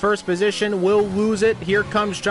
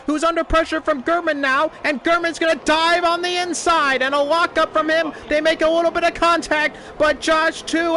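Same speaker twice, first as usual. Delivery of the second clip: motorsport commentator; 9 semitones higher than usual. Acted. Race start play-by-play.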